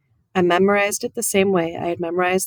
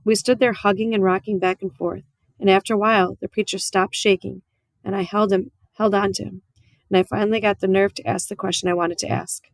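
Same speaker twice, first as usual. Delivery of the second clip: ordinary delivery, nothing marked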